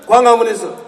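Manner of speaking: ordinary delivery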